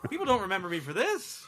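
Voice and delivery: lightly voiced whiny ghost; laughing put-upon ghost complaint; Jim Varney's ghost. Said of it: "imagine, like, Jim Varney's ghost".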